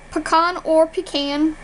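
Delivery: PECAN as "puh-kahn", then "pee-can"; two pronunciations. Shown as a demonstration. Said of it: "How do you pronounce these words?".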